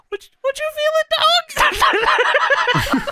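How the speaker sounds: very high, hammed-up Booker. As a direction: squeal it like a hyped-up Booker. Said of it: "But Booker".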